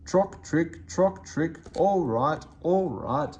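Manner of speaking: grudging creaky vans. Acted. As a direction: answer reluctantly. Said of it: "they answered grudgingly".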